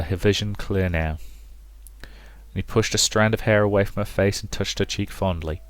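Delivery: normal talking voice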